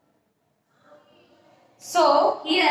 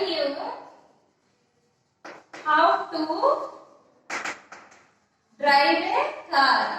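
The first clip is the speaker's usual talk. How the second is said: word by word with long pauses between; slow dictation; slower than usual. Shown as a demonstration. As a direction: dictate it slowly so students can write it down. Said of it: "How will you write it?".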